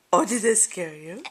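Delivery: ordinary talk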